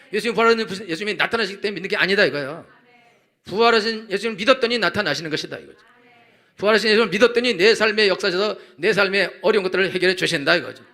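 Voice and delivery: high, forceful preacher's cry; impassioned preaching. Real, not acted. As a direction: preach it fervently, pressing each phrase home.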